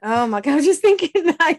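high, loud laughing exclamation; laughing excitedly. Real, not acted. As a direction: laugh it out, thrilled. Excited shared guess.

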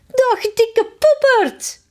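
very high, slow, gleeful call; cheerful call. Acted. Queen calls the king a name, gleefully high. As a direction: sing out the insult with delight. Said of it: "en roept vrolijk".

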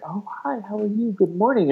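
imitating someone just waking, high and soft; soft, dreamy morning greeting; mock-sleepy sweet hello, lifted and hushed. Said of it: "he's all dreamy".

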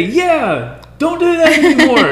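mock wife voice, high and loud; imitating his wife. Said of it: "doing her spaghetti".

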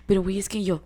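ordinary talk, nothing marked

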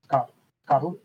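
hesitant try at a Scottish accent; unsure Scottish accent, with a false start; attempted Scottish accent. Shown as a demonstration. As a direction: feel for the Scottish pronunciation. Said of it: "say Scotland in a Scottish accent".

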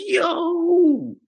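high, drawn-out exclamation; retold stunned wail; mock astonishment. Boyfriend stunned by girlfriend's confession, mock astonishment.

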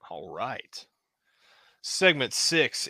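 ordinary delivery

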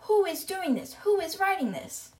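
high, indignant demand at normal volume; retold angry questioning; mock yelling. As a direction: demand answers as an outraged headmistress. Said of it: "Trunchbull is yelling".

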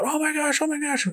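high-pitched, excited coach; freaking out coach. Retold or imitated. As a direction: gush with panicked excitement. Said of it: "her coach is, like, freaking out".

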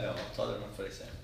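soft giggling through the words; giggling, barely holding it in; laughing quietly. Real, not acted. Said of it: "você fala muitas palavras erradas".

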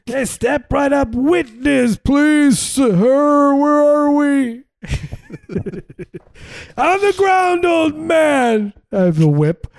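high, loud ringmaster shout; grandiose flea circus showman. Acted. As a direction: bark it like a hammy ringmaster. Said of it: "This is Harold circus".

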